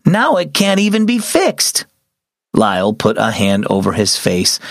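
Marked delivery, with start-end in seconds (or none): 0.0-1.9 high exclaimed dismay; exclaiming. A narrator's reading. Sister scolds Lyle over the broken bike, high exclaiming. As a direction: blurt it out, half alarmed. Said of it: "his sister exclaimed".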